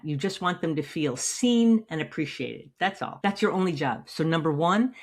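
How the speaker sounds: usual speaking voice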